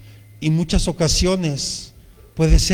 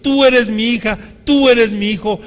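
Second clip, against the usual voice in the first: louder, higher, fervent preaching; preaching at full force; 4 semitones higher, 6 dB louder than usual. Acted. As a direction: proclaim it to the whole congregation. Preaching builds to God's words.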